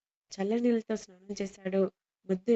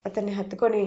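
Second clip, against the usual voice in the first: plain reading voice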